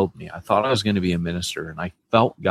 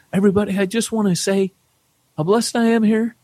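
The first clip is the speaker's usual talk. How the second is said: emotional churchgoer; 13 semitones higher than usual. Retold or imitated. Man stands up mid-service.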